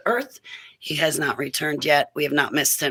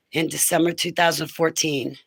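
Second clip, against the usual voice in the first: ordinary delivery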